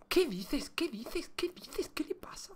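whispered disbelief, very soft; incredulous whisper. Real, not acted. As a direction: repeat it under your breath, voiceless. Repeated disbelief fading into whisper. (susurro de incredulidad)

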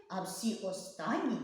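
ordinary delivery

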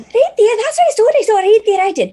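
put-on very high, loud girlish chatter; a giddy teenage maid insisting; excited teen maid. GM voicing maid Teresa to her friend, high and giddy.

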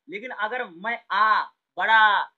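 long आ with the mouth wide open, loud on बड़ा. Shown as a demonstration. Teacher shows pupils a wide open आ, said loud. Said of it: "मेरा जो मुंह है वो ज्यादा खुलता है".

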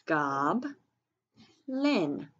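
teacher separating "gob" from "lin"; syllable by syllable. Shown as a demonstration. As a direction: sound out "gob, lin" with a pause between. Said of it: "What's your second syllable?".

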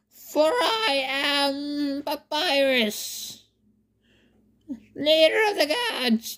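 high, slow, cartoonish skeleton voice; Papyrus voice. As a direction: puff up like a boastful cartoon skeleton. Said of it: "Sans going on about".

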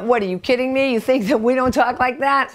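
mock incredulity. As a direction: play the nuns scoffing in disbelief. Nuns' mock incredulous reply.

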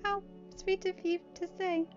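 high-pitched, demure thanks; flattered high mare voice; bashful. Acted. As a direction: accept the compliment with a blush. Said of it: "she blushed".

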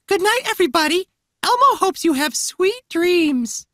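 creepy, very high puppet voice; Elmo. Acted. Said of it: "this one's creepy".

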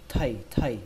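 "thầy" with a puff of air; strongly aspirated th in "thầy", falling tone; extra aspiration. Shown as a demonstration. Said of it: "các bạn đẩy hơi thêm một chút nữa".

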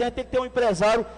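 usual speaking voice

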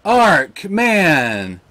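loud exasperated cry; frustrated outburst. Real, not acted. Gamer cursing his pet's death, loud. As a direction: vent at the loss. Pet just killed.

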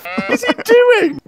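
very high, slow disbelief; incredulous. Real, not acted. Player watching enemy shoot at camera, incredulous. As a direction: squeal it in drawn-out bafflement.

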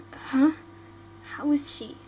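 normal talking voice

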